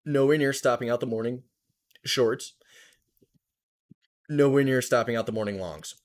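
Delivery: talking through hiccups; hiccuping. Real, not acted. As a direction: hiccups catching the words. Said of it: "Sorry, I'm, like, hiccuping".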